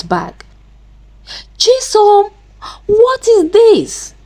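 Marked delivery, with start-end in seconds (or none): 1.6-4.1 high, drawn-out shocked question; great shock. A narrator's reading. Amaka confronts Chisom over the stolen items, shocked. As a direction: demand an answer, pitched right up in shock. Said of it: "In great shock".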